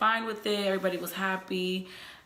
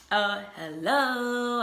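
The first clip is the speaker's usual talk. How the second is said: long drawn-out, higher-pitched "hello"; exasperated hello; 4 semitones higher, 4 dB louder, much slower than usual. Retold or imitated. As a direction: stretch it out as if he's missing the obvious. Exasperated retort to boyfriend's clueless text.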